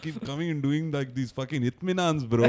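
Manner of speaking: very slow South Indian English drawl; South Indian accent. Shown as a demonstration. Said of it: "South Indian English में मजा आता है".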